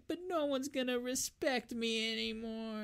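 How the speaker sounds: high, soft, whiny little bee; sad bee voice. Retold or imitated. Dethroned queen bee whining to the heroes, high and soft. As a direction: pout it out like a tiny sulking bumblebee. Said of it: "And she's like".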